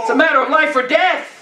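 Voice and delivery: ordinary delivery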